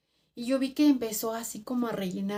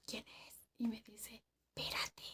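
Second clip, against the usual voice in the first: very faint whispers; two people whispering in the dark hut; 17 dB quieter than usual. Retold or imitated. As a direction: barely breathe the words.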